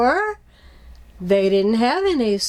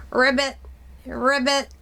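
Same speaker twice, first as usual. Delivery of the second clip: frog ribbit; 2 semitones higher than usual. Shown as a demonstration. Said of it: "That's a frog".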